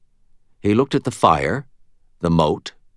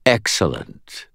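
slow silky purr; slower than usual. A narrator's reading. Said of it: "purred Leah".

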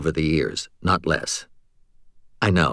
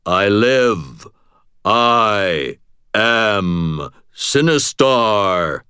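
slow booming game voice; 3 dB louder, much slower than usual. A narrator's reading. Arcade game voice speaking.

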